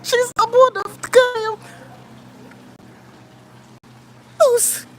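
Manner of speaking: real surprise bursting out at the cat; delighted shock, voice jumping up high; surprised outcry. Real, not acted. Pet suddenly follows her.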